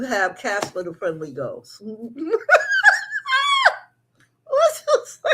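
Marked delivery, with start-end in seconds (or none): 1.9-5.3 high-pitched laughing question. Real, not acted. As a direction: break up laughing as you ask. Laughing at own cartoon comparison.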